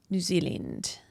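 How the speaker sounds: softly attempted New Zealand accent. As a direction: try on a Kiwi accent, quietly. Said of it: "get into my New Zealand accent".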